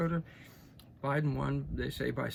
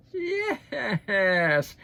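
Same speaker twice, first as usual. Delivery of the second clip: mocking yes; 7 semitones higher, 10 dB louder than usual. Real, not acted. Mocking McConnell's belated support.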